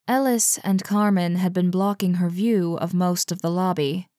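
plain reading voice

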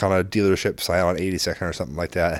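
ordinary delivery

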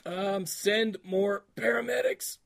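slow, deliberate movie-quote voice; zombie quote. Pretend. Quizzing his cohost, a slow zombie line. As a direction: intone it slowly like a zombie on a radio. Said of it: "Who Else Said That".

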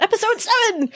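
high, excited swoop on the words; mock excitement. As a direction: playfully overdo the excitement. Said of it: "I sounded so excited about".

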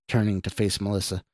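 ordinary delivery, nothing marked

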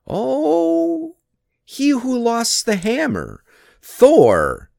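high, drawn-out mocking "oh"; sly taunt. A narrator's reading. Loki singling out Thor who lost the hammer, sly taunt. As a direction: needle him in a high, teasing voice.

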